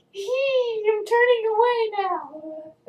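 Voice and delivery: very high, drawn-out squeaky mock voice; high-pitched, embarrassed retold squeal; awkward squeal. Acts out her awkward turning away.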